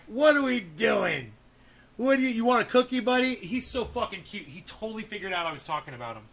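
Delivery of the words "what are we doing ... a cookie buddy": loud high excited voice for the dog. Retold or imitated. Owner voicing his excited dog asking about cookies, loud and high. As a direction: speak for the dog, thrilled and loud. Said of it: "he's like".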